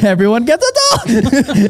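high, drawn-out hype-host announcement through laughter; mock giveaway shout. Host joking about dolls under every seat, mock-excited announcement. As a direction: crow it like a giveaway host, stretching it out.

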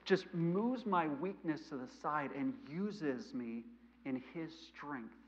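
ordinary delivery

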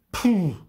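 loud high puffed "Puh"; sharp burst of exasperated breath; snorting puff. A narrator's reading. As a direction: puff it out hard. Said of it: "Luzi prustete".

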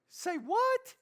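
high, loud, squealed double-take; mock disbelief. Preacher reacting to a verse he dislikes, mock disbelief. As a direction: blurt it out in comic shock.

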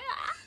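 high, rising put-on ah; a squeaky exasperated ah; mock despairing ah. Describing hard Japanese study, a high mock ah. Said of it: "Terus kayak".

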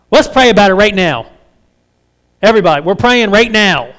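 mock loud prayer summons; showy public announcement. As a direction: make a show of calling everyone to prayer. Showy public pray-er, heard by all.

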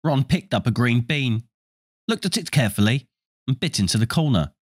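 ordinary delivery

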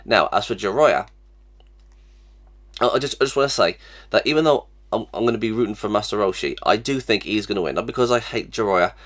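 ordinary talk, nothing marked

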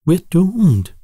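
high, loud, frightened gasp; gasping in fear. A narrator's reading. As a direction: blurt it out as if all is lost. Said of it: "T K thirteen gasps".

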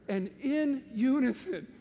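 ordinary talk, nothing marked

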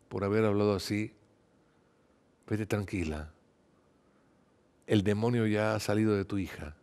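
soft, low, unhurried reassurance; gentle reassurance. A narrator's reading. Jesus sending the mother home in peace, gentle reassurance. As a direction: reassure her softly, pausing between phrases.

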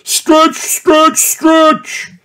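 high, sing-song stretch commands; coach call. A narrator's reading. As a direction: chant it to lead the class. Gym teacher calling out.

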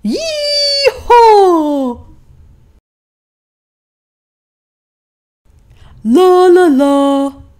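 very high whoop then singsong; donkey whooping with joy; whooping cheer. Acted. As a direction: whoop like a cowboy. Donkey celebrating.

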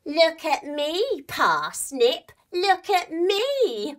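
high, slow taunting mouse; slow high teasing mouse. Acted. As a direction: tease her in a squeaky mouse voice. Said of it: "started going".

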